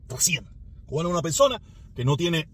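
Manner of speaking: ordinary delivery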